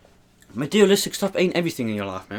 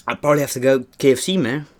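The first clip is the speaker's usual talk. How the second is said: ordinary delivery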